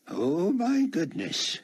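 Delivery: put-on high, slow, drawn-out old codger; husky old man. A narrator's reading. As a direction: exclaim like a scandalized old man. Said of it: "he said, still in character".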